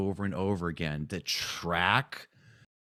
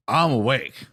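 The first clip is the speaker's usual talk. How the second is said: mock declaration; 4 semitones higher, 6 dB louder than usual. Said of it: "he was very evangelistic all of a sudden".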